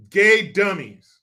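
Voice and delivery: normal talking voice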